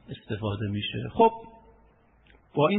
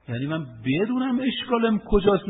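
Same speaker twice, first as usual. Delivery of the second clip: ordinary delivery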